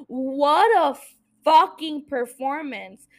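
real amazement at a great scene; awed praise. Real, not acted. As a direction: gush over the acting. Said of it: "I got all emotional".

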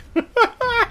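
squealing laugh; high-pitched cackle. Real, not acted. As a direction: burst into shrill laughter.

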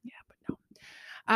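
whispered deadpan aside; hushed yeah but no. Real, not acted. Deadpan aside on Ouija name.

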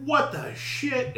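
high, drawn-out disbelief; baffled astonishment. Retold or imitated. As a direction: re-enact slow, stunned surprise. Acts surprise at seeing the animal.

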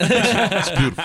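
a hint of Trump showing off; Trump impression. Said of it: "I'm Trump voice".

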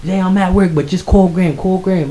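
high, loud imitated sister; retold high-pitched insistence; urgent excitement. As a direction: urge the call, worked up. Excited sister urging him to call.

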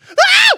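very high, loud shriek; piercing hammed-up scream; mock shriek. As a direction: shriek like a terrified fisherman. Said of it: "that's how I imagine that shriek".

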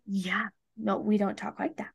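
hushed, matter-of-fact parental reminder; gentle correction. Real, not acted. Mother gently corrects her whining toddler.

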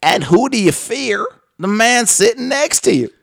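hammed-up host's confrontation; Doctor Phil. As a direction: press the guest with a dramatic question. Mimicking host confronting frightened guest.

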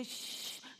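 long hissed "shh"; drawn-out mimicked hushing sound; imitated TikTok shush. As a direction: shush like the little TikTok girl. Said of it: "la chiquita de TikTok que te dice".

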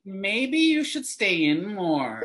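high, drawn-out Valley accent imitation; mock a Valley relative's accent, stretched out; exaggerated South Texas Valley lilt, slow and high. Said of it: "It's that accent".

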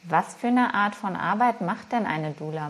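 usual speaking voice

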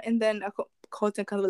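normal talking voice

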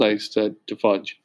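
plain reading voice

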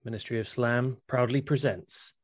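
slow, grand announcer intro. Acted. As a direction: present it like a showman. Announcing the promo segment.

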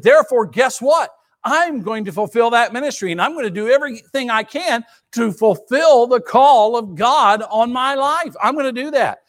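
quoted vow preached with rising fervour; fired-up resolve. Retold or imitated. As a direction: declare it like a man who won't quit. Voicing Paul's resolve to fulfill ministry.

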